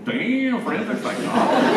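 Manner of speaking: high, loud, laughing disbelief; amazed laugh. Retold or imitated. Priest hearing the girl's answer, high and laughing with delight. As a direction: marvel at it through a laugh.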